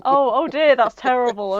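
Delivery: loud, put-on dismay on a fake call; hammed-up "oh dear" into the phone; pretend phone reaction. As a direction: feign shock at bad news. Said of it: "pretending like he's hearing Obama talk back".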